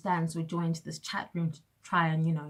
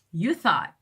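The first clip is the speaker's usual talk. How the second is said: sarcastic retort; 2 semitones higher, 8 dB louder than usual. Retold or imitated. Sarcastic retort in her head.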